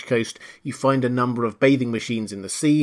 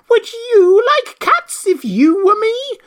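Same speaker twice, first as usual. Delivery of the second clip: very high, loud, drawn-out squeal of outrage; shrill, passionate mouse; 9 dB louder, slower than usual. A narrator's reading. The mouse cries out at Alice, shrill and passionate. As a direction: shriek slowly as an outraged mouse. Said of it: "in a shrill, passionate voice".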